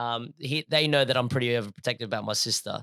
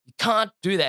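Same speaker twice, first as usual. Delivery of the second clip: higher, louder staged protest; mock confrontation; 6 semitones higher, 5 dB louder than usual. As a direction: square up to him, half-acted anger. Acted gym confrontation.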